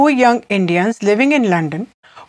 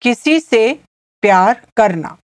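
teaching repeat; much faster than usual. Shown as a demonstration. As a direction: repeat it in short pieces for a learner. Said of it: "I'll repeat".

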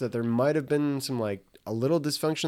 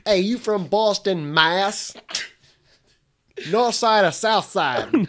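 higher mock Boston accent; 6 semitones higher than usual. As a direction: quiz someone as a broad fake Bostonian. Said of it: "that's not a good Boston accent".

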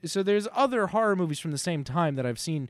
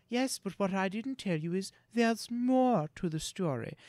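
lightly voiced Mary Shelley, a touch higher; mock Mary Shelley; 5 semitones higher, 3 dB quieter than usual. Mary Shelley teasing her friends with more story, lightly voiced. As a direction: tease it like a spooky storyteller. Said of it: "she goes".

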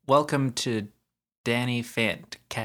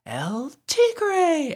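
announcer voice; 3 dB louder than usual. Retold or imitated. Imitating the ad stinger.

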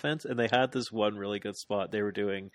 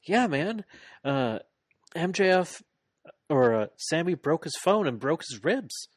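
mock casual; 7 semitones higher than usual. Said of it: "He's like".

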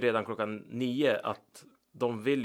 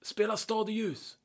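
a lightly voiced fan with a high, pushy request; mock party guest demanding a song; imitating the fan; 10 semitones higher, 5 dB quieter than usual. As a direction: mimic the guy begging for the song, pitched up. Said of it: "Och så säger han".

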